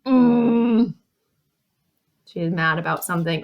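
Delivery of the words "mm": cross, louder closed-mouth growl of "mm"; upset grunt. Shown as a demonstration. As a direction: grumble a pent-up mm.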